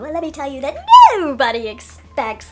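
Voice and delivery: hammed-up sketch voices with a loud burst; mock-dramatic announcement, swooping into a loud reveal; Monty Python. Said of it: "I'm mocking Monty Python".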